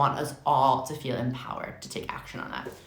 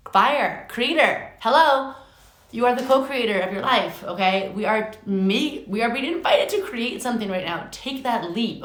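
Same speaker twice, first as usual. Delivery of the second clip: higher and louder, bursting with excitement; excited reveal; 7 semitones higher, 6 dB louder than usual. Real, not acted. Reader revealing the fire card to viewers, thrilled. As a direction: announce the card like big news.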